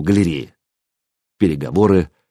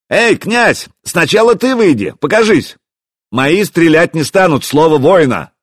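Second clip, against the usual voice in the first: high, raised shout; calling out; 7 semitones higher, 4 dB louder than usual. A narrator's reading. Hero urging the prince to show himself, calling out. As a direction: hail the enemy and demand he appear.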